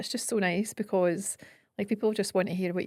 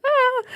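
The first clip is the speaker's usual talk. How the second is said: mock cringe squeal; 11 dB louder than usual. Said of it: "Oh God, do I want to see myself?".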